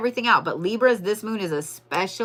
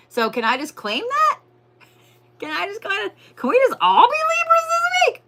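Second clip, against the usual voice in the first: high pleading squeal; 8 semitones higher than usual. Real, not acted. Reader begging Libras to share their luck, high squeal. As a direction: pitch way up, begging playfully.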